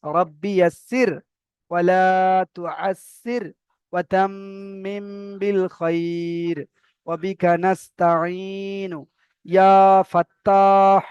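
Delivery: slow Arabic supplication; slow dua recitation. Shown as a demonstration. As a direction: chant the prayer slowly, Arabic cadence. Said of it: "দেখেন".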